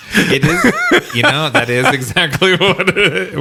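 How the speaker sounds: talking through laughter, high-pitched; laughing. Real, not acted. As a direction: talk while laughing hard. Laughing while agreeing.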